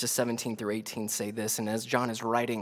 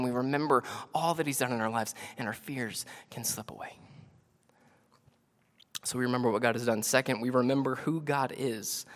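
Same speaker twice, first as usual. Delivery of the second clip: normal talking voice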